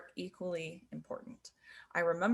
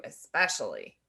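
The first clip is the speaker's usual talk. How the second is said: especially face; 8 semitones lower than usual. Shown as a demonstration. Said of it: "changes the meaning".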